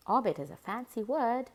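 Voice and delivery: ordinary delivery, nothing marked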